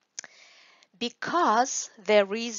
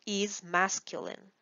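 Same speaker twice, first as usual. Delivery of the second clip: usual speaking voice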